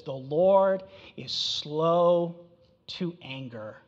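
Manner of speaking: drawn out very slowly, fading at the end; very slow scripture reading; slow, weighty quote. Shown as a demonstration. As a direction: linger on each word of the verse. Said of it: "But then Nahum says what?".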